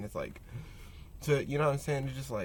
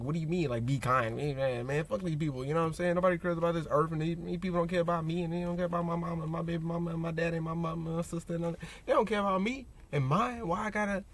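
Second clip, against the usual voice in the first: higher, rushed mock complaint; imitating someone griping, words tumbling out faster; acted indignant rant; 4 semitones higher, faster than usual. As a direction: rattle off grievances as a fed-up cynic. Voices cynical people rejecting kindness.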